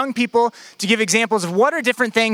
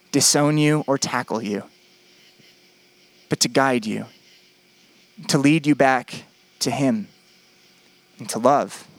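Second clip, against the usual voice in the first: gentle, tender; 7 semitones lower than usual. Acted. Tender turn in the sermon.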